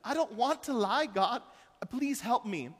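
put-on high, pleading little girl voice; high tearful child praying to God; child's broken prayer. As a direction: pray like a remorseful five-year-old. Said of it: "this just broken prayer".

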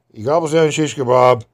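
ordinary talk, nothing marked

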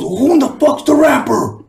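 high, indignant mock yell; a touch of a petulant rapper; mock angry rapper. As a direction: squeak out the offended artist. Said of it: "He goes, he goes".

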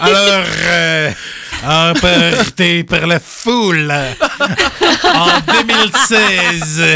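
put-on slow, drawn-out Belgian accent; put-on Belgian accent, half laughing at the end; mock Belgian accent. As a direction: stretch it out in a hammed-up Belgian accent. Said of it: "on part sur de l'accent belge".

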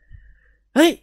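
sudden high yelp of alarm; startled exclamation. A narrator's reading. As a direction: blurt it out in shock. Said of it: "อาเสียอุทาน". (ร้องอุทานตกใจ)

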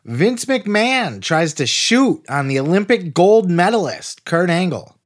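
high, slow hyped announcer read; announcer intro. Acted. Host opening the wrestling podcast, announcer hype. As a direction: sell the show like a big promo.

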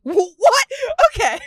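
high, loud laughing shock; laughing disbelief. Real, not acted. Laughing shock at courtship timeline.

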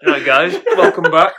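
loud welcome through laughter; laughing. Real, not acted. Host welcoming listeners after a silly intro, laughing. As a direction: greet them while cracking up.

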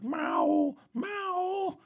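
very high, slow drawn-out meows; cat meowing. Retold or imitated. Dad imitating the cat calling after him, high meows. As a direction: mimic a needy cat calling. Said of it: "she's like".

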